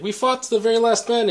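put-on high-pitched German-accented movie villain. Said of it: "in his German accent".

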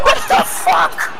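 shocked scream. Real, not acted. Stunned by run-in, screaming.

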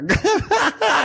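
sudden high burst of laughter; high-pitched cackling crack-up; imitated cracking up. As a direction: break into laughter like an actor losing it. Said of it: "he starts cracking up on the monitor".

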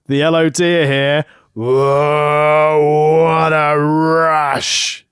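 loud, long drawn-out roar; roaring catchphrase. Acted. Co-host doing the Legion of Doom's entrance catchphrase, roared. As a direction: belt it out, stretching the words right out. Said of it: "my throat could not take that".

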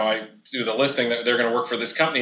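ordinary talk, nothing marked

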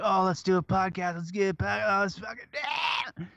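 overexcited mock pitch rising to a shout; eager mock excitement. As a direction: imitate over-keen friends pitching an idea. Mimics eager friends starting podcast.

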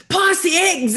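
high, loud Aussie larrikin voice; Steve Irwin voice. Retold or imitated. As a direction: ham up an excitable croc-hunter. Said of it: "Was he like".

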